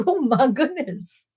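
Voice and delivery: drawn-out, playfully embarrassed; hammed-up embarrassed reaction; mock self-conscious. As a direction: act bashfully overwhelmed, slowly. Acting out her own self-conscious reaction.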